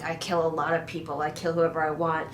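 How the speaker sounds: low, quiet threat, imitating a villain; mock menacing. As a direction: retell it as an understated, low menace. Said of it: "he's all menacing".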